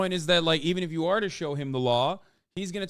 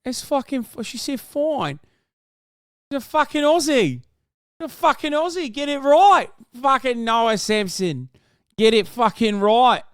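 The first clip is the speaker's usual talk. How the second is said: put-on higher, louder overdone Australian accent; bad Aussie accent; 7 semitones higher, 5 dB louder than usual. Co-host insisting the guest is an Aussie, in a loud, bad Australian accent. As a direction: hammer out a terrible Aussie accent, rising to a shout. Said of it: "That's a terrible Aussie accent".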